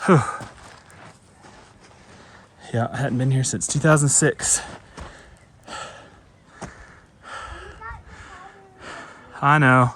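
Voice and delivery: panting between words after the climb; winded, breathing hard while talking. Real, not acted. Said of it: "Sorry, I'm out of breath".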